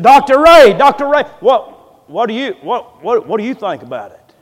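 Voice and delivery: loud, high eager call to an elder, easing off by the end. Retold or imitated. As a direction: imitate preachers loudly pressing someone for an opinion. Preachers call on Doctor Ray.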